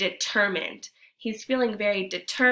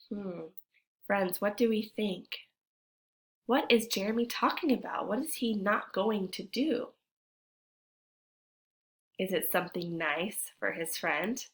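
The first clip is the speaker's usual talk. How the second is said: ordinary talk, nothing marked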